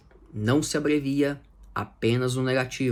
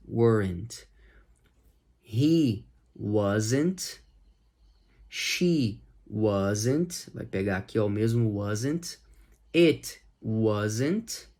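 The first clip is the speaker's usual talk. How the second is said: ordinary delivery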